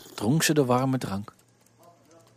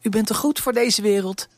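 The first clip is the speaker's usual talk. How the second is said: put-on higher, girlish voice, a bit timid; poor servant girl, lightly voiced; young girl Elise; 9 semitones higher, 4 dB louder than usual.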